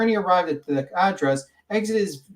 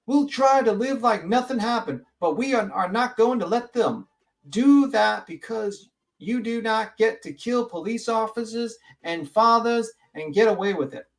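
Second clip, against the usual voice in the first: raised, righteous Westerner address; Westerner vigilante voice; 6 semitones higher than usual. Acted. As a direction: declare it like an Old West vigilante. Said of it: "I know I sound like a Westerner".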